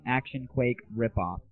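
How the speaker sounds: ordinary delivery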